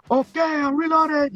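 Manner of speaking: high, shouted gangster movie line; movie gangster impression. Young fan shows his friend his favorite movie part, terrible gangster impression. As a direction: holler it like a cocky film tough guy. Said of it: "that's a terrible impression you're doing of him".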